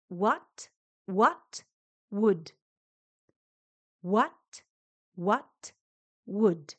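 "What" and "would" start alike, a clean rounded w glide; slow drill of "What, what, would", each w from rounded lips; repeat-after-me drill. Shown as a demonstration. Teacher drilling Spanish speakers on the w sound, slow and clear.

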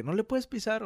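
imitated high-pitched pleading request; pushy girl passenger. Girl asking the bus driver to step on it, imitated pushiness. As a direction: raise the pitch, nudging the driver along. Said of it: "la chava le decía así como que".

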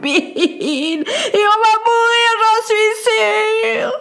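high, sobbing noblewoman, slowing into a wail; a lady sobbing out her story, sure she will die; crying lady. A narrator's reading. Lady explains to the boy how she got trapped, high and sobbing. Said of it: "se remit à pleurer de plus belle".

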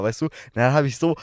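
normal talking voice

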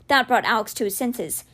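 usual speaking voice

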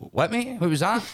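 a retold sharp challenge, half-hearted anger; mock confrontation. To the star bad-mouthing him, a mock challenging what-mate. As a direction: pitch shoots up high in a challenging what-mate.

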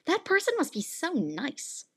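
high, slow mock gushing; admiring listener. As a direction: play someone charmed by an accent. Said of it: "hear a Southern accent and think".

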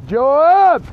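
calling out. Acted. Old father calling after Joab in the distance, loud call. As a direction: call his name to someone far down the road.

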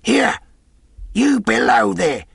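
high, loud hailing shout; demanding yell, drawn out at the end; cried out. A narrator's reading. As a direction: call sharply to the man below. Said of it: "cried the blind man again".

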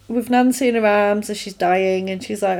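ordinary delivery

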